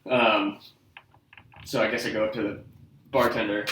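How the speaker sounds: ordinary talk, nothing marked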